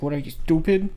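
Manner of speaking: lightly put-on Indian accent, indignant; retold question in a rough Indian accent; imitated Indian accent. Retelling the driver who got hit, imitated Indian accent. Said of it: "it was an Indian guy, so he had, like, that accent".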